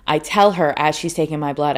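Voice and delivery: ordinary delivery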